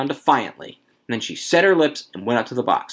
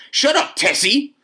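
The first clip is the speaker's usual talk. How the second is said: high, drawn-out telling-off; sharp rebuke; 3 dB louder, slower than usual. A narrator's reading. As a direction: silence her with a high, slow command. Husband silencing his protesting wife.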